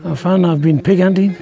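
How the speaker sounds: usual speaking voice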